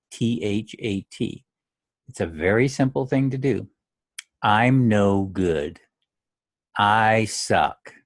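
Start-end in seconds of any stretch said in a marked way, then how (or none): 4.4-7.9 slow, heavy self-criticism; heavy self-blame. Shown as a demonstration. Golfer beating himself up, slow and heavy. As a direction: weigh each word down. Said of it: "Do you hear the difference in my voice".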